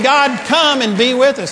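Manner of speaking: high, loud mock plea to God; hammed-up prayer call. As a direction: imitate an earnest congregant praying aloud. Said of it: "And yet we're praying".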